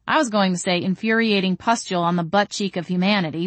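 ordinary delivery

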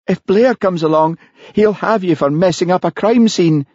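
high, scared Highland constable; frightened Charlie. Acted. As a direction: voice a spooked big lad. Charlie voice again.

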